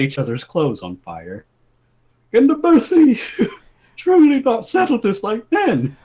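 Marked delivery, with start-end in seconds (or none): 2.3-6.0 high, loud pleading king, breaking into laughter; king's plea. Acted. King pleading with the brawlers, high and loud. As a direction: beg the brawlers like a flustered monarch. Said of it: "the king asked".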